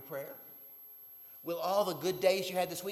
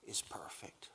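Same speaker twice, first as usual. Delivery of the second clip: whisper; 7 semitones lower, slower than usual. Acted. Preacher drops voice for emphasis.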